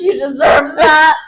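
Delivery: loud, slow mock-tearful jab; hammed-up drunken retort. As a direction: drag it out loudly, overacting. Acted drunken retort after throwing up.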